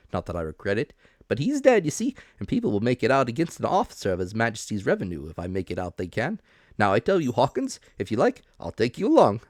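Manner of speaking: excitable officer voice. A narrator's reading. Mister Dance voiced in story.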